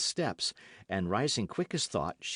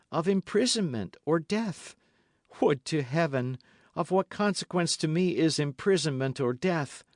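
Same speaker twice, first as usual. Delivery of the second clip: higher, urgent supplication; a hint of a desperate captive; despairing woman's plea; 8 semitones higher, 3 dB louder than usual. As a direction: beg him as a martyr would. Milady pleading with Felton.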